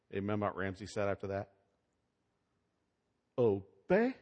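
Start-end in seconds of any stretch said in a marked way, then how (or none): 3.4-4.1 sarcastic. Retold or imitated. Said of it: "Just real sarcastically".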